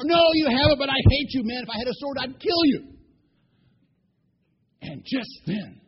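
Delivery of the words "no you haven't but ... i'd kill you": loud, high ranting; hammed-up shouted threat; furious outburst. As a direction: rage at the donkey, loud and high. Balaam rages at the donkey.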